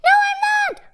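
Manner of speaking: put-on very high squeaky child protest; high-pitched squealed denial; crying out. A narrator's reading. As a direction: cry out shrilly as a little boy. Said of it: "cried the voice".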